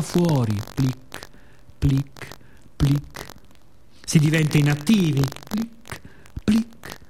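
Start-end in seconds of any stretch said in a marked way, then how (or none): 0.7-3.3 imitated raindrop plic. 5.5-7.0 high raindrop plic. Acted. Imitating raindrops on window.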